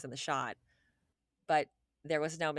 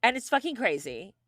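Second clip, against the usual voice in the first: normal talking voice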